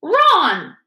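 high, shouted name; loud startled call. A narrator's reading. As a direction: bark the name to make them jump. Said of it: "a loud voice made all three".

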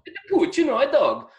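high, cheerful mock Italian chef; high chef cheerfully naming the meat. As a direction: proudly announce it like the chef. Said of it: "And he went".

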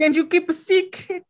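very high, hammed-up Italian; mock Italian accent. Quoting a film line to co-hosts, mock Italian accent. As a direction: pipe it up in cartoon Italian.

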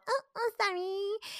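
put-on high, slow little-kid apology; apologetic small child. Mom imitates her little one told off, apologetic.

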